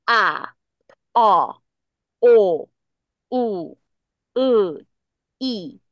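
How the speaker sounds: "啊、哦、哦、u、u、i" as single unchanging vowels; each vowel goes straight out, no glide, spaced apart; 单一纯母音. Shown as a demonstration. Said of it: "声音都没有变， 它只有一个音出去， 直直的出去而已". (啊、哦、哦、u、u、i每个音直直出去，嘴型不变)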